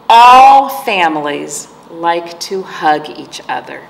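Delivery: put-on slow storytime reading to kids; picture book read aloud; story reading. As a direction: read each page gently and slowly. Reads The Family Book aloud.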